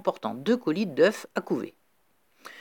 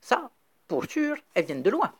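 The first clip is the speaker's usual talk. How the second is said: lightly voiced old farmer; 8 semitones higher than usual. A narrator's reading. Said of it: "opina Mister Alsop".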